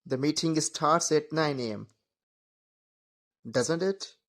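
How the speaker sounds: Doesn't it lifted up at the end, unsure; rising tag. Shown as a demonstration. Unsure colleague checking meeting time, rising tag. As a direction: ask Doesn't it with a genuine upward question tune. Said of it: "want real confirmation".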